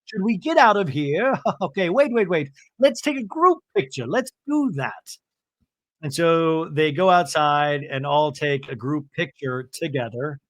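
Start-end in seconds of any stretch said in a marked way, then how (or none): 0.1-5.2 high-pitched, excitable organizer impression; hammed-up, high and eager; Bronwyn. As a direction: round up the group in a high voice. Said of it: "Bronwyn comes out with Todd".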